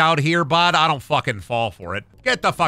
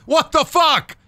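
exasperated outburst; 8 semitones higher, slower than usual. Real, not acted. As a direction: cry out at the absurd pile of trowels.

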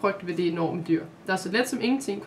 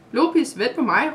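louder call after a running dog; 4 semitones higher, 7 dB louder than usual. Acted. Maja calling to the dog running ahead, raised call. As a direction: shout after him, a bit higher. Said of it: "råbte hun".

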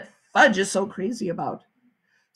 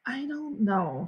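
slow, softer, unsure; hesitant; 3 semitones higher, 8 dB quieter, much slower than usual. A narrator's reading. Mother unsure about leaving Fudge, slow soft hesitation. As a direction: draw it out doubtfully. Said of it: "My mother hesitated".

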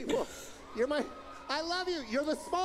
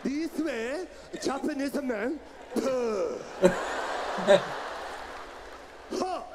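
gruff Japanese man; 2 semitones lower than usual. Retold or imitated. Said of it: "come from diaphragm".

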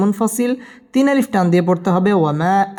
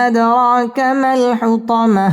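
slow, sung tajweed chant; Quran recitation; 4 semitones higher, much slower than usual. Shown as a demonstration. Teacher reciting the surah for students to follow, chanted. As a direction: let each line ring out melodically.

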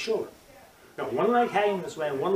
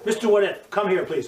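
calling someone over; 6 semitones higher, 6 dB louder than usual. Retold or imitated. Acts coach calling a camper over.

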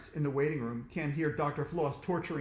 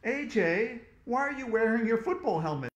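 higher, louder questioning mom; puzzled mom; 7 semitones higher, 5 dB louder than usual. A narrator's reading. Mom asks AJ about the football helmet, puzzled. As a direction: ask it like a baffled mother.

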